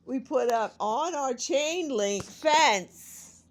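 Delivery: loud, slow exasperation; upset about the dead cat and flies, loud and slow. Real, not acted. Upset over flies and fence.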